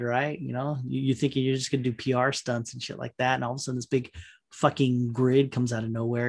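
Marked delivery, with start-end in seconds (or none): none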